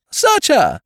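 shrill high scream of search. A narrator's reading. Chambermaid screaming at the French maid to search her. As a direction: shriek it as an accusation. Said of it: "screamed the other".

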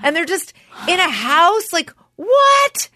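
high, loud, gushing disbelief; astonished excitement. Retold or imitated. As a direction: exclaim in hammed-up amazement. Acts out amazement at reality TV.

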